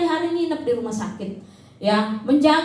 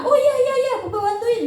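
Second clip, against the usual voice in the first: willing husband; 7 semitones higher than usual. Retold or imitated.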